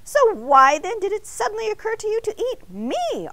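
high, loud, indignant demand. A narrator's reading. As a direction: press him for an answer, outraged. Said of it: "Arnie demanded".

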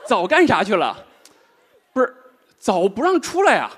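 ordinary talk

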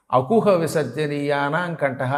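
slow, measured Sanskrit rule recital; sutra recitation. Shown as a demonstration. Guru answers his own question with the sutra, slow recitation. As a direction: recite the Panini sutra slowly, vowels held long. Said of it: "పాణినీయ వ్యాకరణంలో సూత్రం ఏమిటి".

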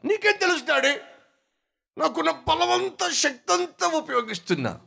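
put-on a small kid insisting he's trying; child's voice. Child argues with father.

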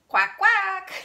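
loud, high duck quack. Shown as a demonstration. Teacher getting children to quack along, loud high duck quack. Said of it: "Let me hear you say quack".

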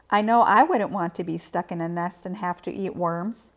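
usual speaking voice

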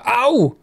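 a sudden high yelp of pain; sharp ouch. A narrator's reading. Tora hits her head on the door frame, sharp yelp.

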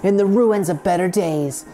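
reading the story intro like a movie trailer, words trailing off; dramatic narration. Acted. Gamer reading the game intro, trailer-like. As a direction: read it like a trailer, grand.

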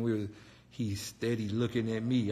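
ordinary delivery

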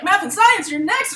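high, loud hyped-up announcing; announcer hype. Retold or imitated. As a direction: sell it like a show opener. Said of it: "She's like".

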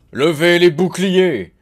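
loud, high, drawn-out battle order; roared command. A narrator's reading. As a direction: roar it out to the troops. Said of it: "rugit Gunther". (ordre rugi à pleine voix)

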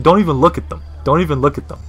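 usual speaking voice

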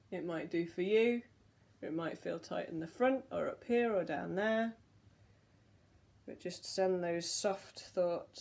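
guided relaxation. Real, not acted. Leads relaxing neck circles.

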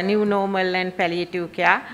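normal talking voice

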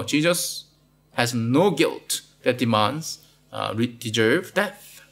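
ordinary talk

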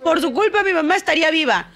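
high-pitched retold accusation; heightened reproach. Mother blames the doctor in retelling, heightened reproach.

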